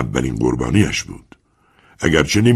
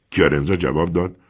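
ordinary delivery

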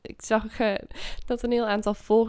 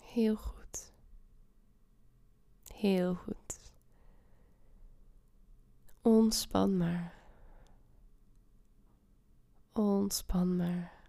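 soft close whisper; right in your ear; 4 dB quieter, much slower than usual. Acted.

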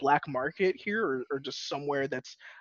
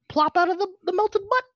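excited squeal; 6 dB louder than usual. Real, not acted. Laughing excited retelling.

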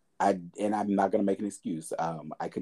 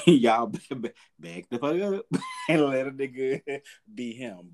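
higher, laughing "back off" to nosy friends; laughing exasperation; 7 semitones higher, 4 dB louder than usual. Real, not acted. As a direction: laugh it off while pushing them away. Fed up with friends' concern.

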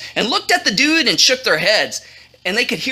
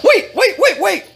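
high, urgent interruption; 7 semitones higher than usual. A narrator's reading. Cousin stops her over her name, fretful. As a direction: halt her in a fretting, pitched-up voice.

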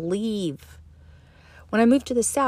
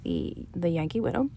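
ordinary talk

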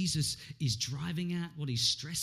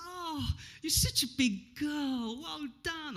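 much higher, slow, tender praise; high cooing praise to his toddler; praising a toddler; 10 semitones higher, slower than usual. Retold or imitated. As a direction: gush over a little girl. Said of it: "I said".

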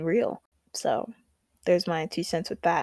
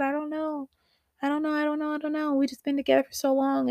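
helpless high whimper; 8 semitones higher, 3 dB quieter, faster than usual. Retold or imitated. Retelling her helpless answer to friend.

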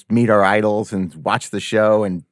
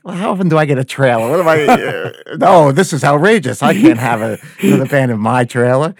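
mock outrage; 3 semitones higher, 4 dB louder than usual. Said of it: "And I'm thinking, like".

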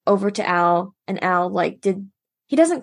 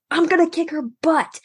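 high, indignant yell, held back in volume; mock yell; 8 semitones higher than usual. Ed talking about Panina, high mock yell. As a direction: imitate a hot-headed boy declaring a fight. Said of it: "he yells".

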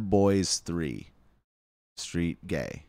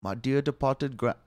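put-on higher-pitched stab at a Jamaican accent; amateur Jamaican impression, pitched higher; attempted Jamaican accent; 6 semitones higher than usual. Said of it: "do a Jamaican voice".

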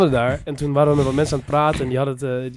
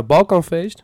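"balkanfeest" wat luider en hoger uitgeroepen; said loudly; 5 semitones higher, 5 dB louder, slower than usual. Shown as a demonstration. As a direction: roep "balkanfeest" er nadrukkelijk uit. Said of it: "Zo, dat zeg ik even heel hard". ("balkanfeest" luid uitgesproken)

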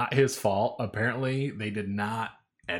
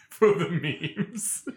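slow, high, laughing echo; 9 semitones higher, 4 dB louder, much slower than usual. Real, not acted. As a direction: laugh the words out slowly. Echoing through laughter.

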